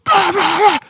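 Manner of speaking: very high, loud gibberish rant; imitating a ranting passenger; mock ranting. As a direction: blabber like an angry man. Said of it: "a voice going louder than it should be".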